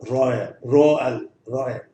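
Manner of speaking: रॉयल repeated with a very short a; drill repetition. Shown as a demonstration. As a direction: repeat रॉयल, clipping the last vowel. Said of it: "बहुत short में ए आता है".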